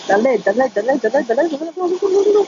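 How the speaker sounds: rapid-fire, pitch climbing to a squeal; frantic cartoon repetition. Quoting frantic SpongeBob repetition.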